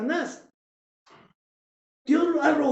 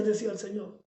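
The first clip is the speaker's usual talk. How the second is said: usual speaking voice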